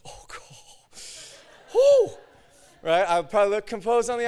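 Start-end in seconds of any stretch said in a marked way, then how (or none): 0.1-1.0 breathy whispered prayer, acted; panicked whisper. Preacher about to demonstrate healing, panicked whisper. 1.7-2.2 high, loud acted whoo; nervous whoop. Preacher freaking out inside before demonstrating, nervous whoop.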